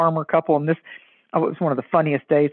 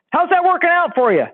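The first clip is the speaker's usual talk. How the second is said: sarcastic; 11 semitones higher than usual. Real, not acted. Pointed quip at people's excuses.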